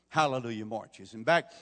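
ordinary talk

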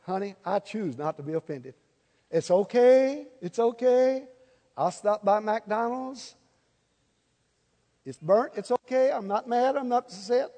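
soft, overly sweet reassurances to a spouse; lightly sugary, patient tone; mock sweet reassurance. Shown as a demonstration. Husband reassuring his wife over burnt dinner, softly sweet. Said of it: "Say it like you mean it".

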